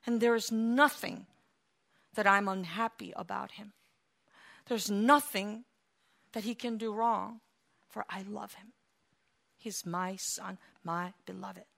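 soft, hushed, tender fatherly words; God softly assuring love for his son. Acted. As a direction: speak it softly, like a loving father. God's tender words voiced.

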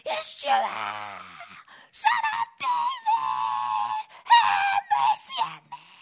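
a blind beggar screaming over the crowd for mercy; desperate scream. Retold or imitated. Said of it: "you would have to scream, scream, scream, cry out".

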